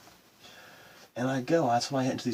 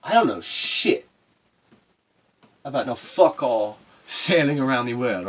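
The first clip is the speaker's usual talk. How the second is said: emphatic; 9 dB louder than usual. Real, not acted. Boasting about sailing ignorance.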